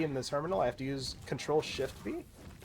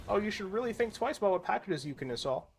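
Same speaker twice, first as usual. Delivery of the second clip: higher-pitched mock nagging; put-on higher voice mocking someone's advice; mocking imitation; 7 semitones higher than usual. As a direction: mimic a preachy know-it-all, pitched up. Mimicking naggers' advice before rebutting it.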